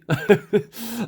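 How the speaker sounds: short high-pitched laugh; high giggle. Real, not acted. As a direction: giggle lightly. Laughs about tippy bottles.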